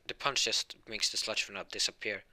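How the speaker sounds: plain reading voice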